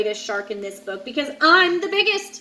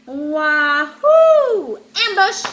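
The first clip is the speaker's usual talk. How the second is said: louder, playful war cry; gleeful ambush; 3 semitones higher, 7 dB louder, much slower than usual. Acted. Angel shark acting out its sneak attack, gleeful whoop. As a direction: leap out with a whoop.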